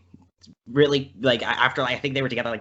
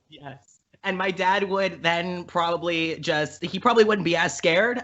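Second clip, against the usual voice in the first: ordinary talk, nothing marked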